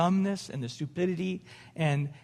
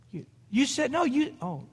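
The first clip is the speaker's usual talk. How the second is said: flustered wife; 6 semitones higher than usual. Retold or imitated. Imitating wife's flustered protest.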